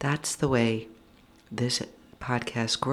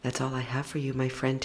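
ordinary talk

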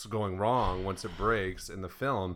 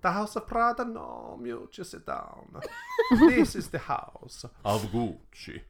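hammed-up diva with big pitch swings; slow, grand reveal of the name; theatrical film-trailer character; 9 semitones higher, 4 dB louder than usual. Acted intro skit, others laugh.

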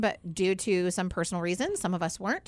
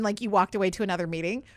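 ordinary talk

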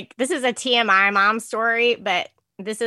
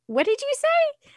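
surprised question. Retold or imitated. Mom startled by son's remark.

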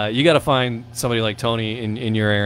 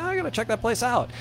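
high, softer voice for a quoted eager thought; eager retelling; 11 semitones higher, 6 dB quieter than usual. Retold or imitated. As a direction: act out mild excitement, pitched well up. Quoting own eager reaction.

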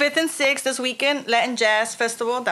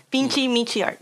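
usual speaking voice

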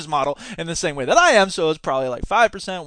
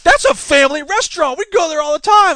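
higher and louder, mock indignant; mimicking offended students defending Hooters; offended protest; 10 semitones higher, 5 dB louder than usual. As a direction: imitate a student's huffy defence. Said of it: "they were angry, they were offended".

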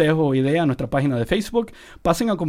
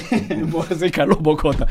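laughing. Real, not acted. Laughing, joins the name joke.

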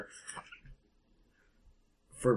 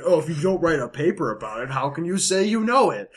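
snooty academic; 6 semitones higher, 5 dB louder than usual. Retold or imitated. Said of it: "you sound like the snooty academic who's like".

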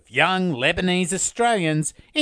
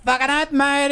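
put-on a light, slightly higher Centrelink-queue Aussie accent; 5 semitones higher than usual. Cohost jokes in his Centrelink Australian accent for the others. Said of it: "It's my Centrelink accent".

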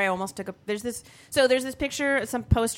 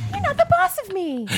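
bratty voice. Acted. Mock defiance, high voice.

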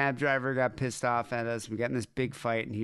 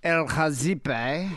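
slow drawn-out El Hazibe with an English lilt at the end; mock Arabic with an English finish; English-tinged Arabic; 3 semitones higher, 3 dB louder, much slower than usual. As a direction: stretch El Hazibe out slowly, ending English. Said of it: "you went a bit English at the end".

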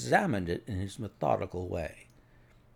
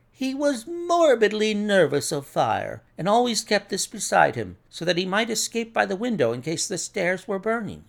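higher-pitched doctor's voice; anxious doctor, explaining; 7 semitones higher than usual. Acted. Doctor explains the dead man's fear of fire, earnest.

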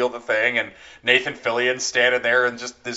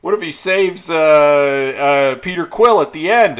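higher, louder excited pitching; eager pitcher; 5 semitones higher, 5 dB louder than usual. Retold or imitated. The writer pitching the next twist, mock eager. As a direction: build the idea with glee. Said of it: "they're like".

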